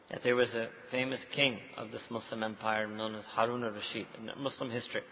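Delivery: ordinary delivery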